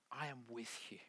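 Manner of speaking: soft, intimate reassurance; hushed promise. Acted. God assuring the listener who leans in, hushed. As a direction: drop the voice right down for the promise. God's whispered promise closing the quote.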